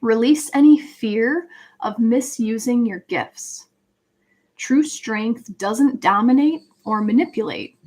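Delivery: ordinary delivery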